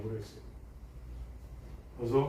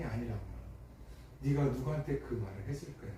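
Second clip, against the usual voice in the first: forgive him quietly, lowering the voice; gentle forgiveness; 2 semitones lower, 8 dB quieter than usual. Retold or imitated. Older brother forgiving younger one.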